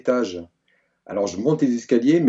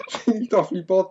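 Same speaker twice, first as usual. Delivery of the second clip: laughing; 8 semitones higher than usual. Real, not acted. Laughing while scolding friend.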